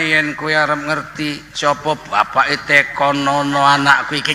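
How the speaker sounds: slow, drawn-out Javanese speech. Retold or imitated. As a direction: say it in broad Javanese, slowly. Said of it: "Kalau bahasa Jawanya".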